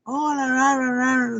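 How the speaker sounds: a light mock of a tiny, sugary hello; tiny morning voice. A friend's voice message greeting, lightly imitated tiny voice. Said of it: "la vocecilla así bajita en la mañana".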